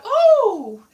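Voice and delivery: high, loud mock "oh" of surprise; delighted surprise. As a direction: imitate mum, thrilled at being offered a seat. Retelling mum's reaction to seat offer.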